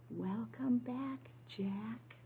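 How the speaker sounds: soft, slow whisper to a friend. Acted. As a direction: breathe it like a secret. Tim greets his jack-o'-lantern.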